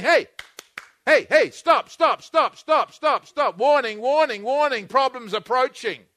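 God's inner warning shouted as an alarm; urgent warning. Acted. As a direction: call out like an alarm going off. God's warning acted out loud.

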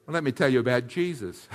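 usual speaking voice

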